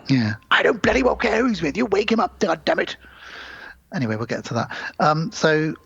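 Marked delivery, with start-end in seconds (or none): 0.5-3.0 mock British bluster. Quoting gruff British boozer.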